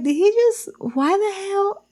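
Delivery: high, laughing disbelief; can't believe he did it; stunned disbelief. Retold or imitated. Shoe thrown across the street, high stunned disbelief.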